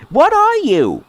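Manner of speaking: put-on high childlike fairy question. Fairy poking the stranger, high curious voice. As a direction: ask up high and nosy.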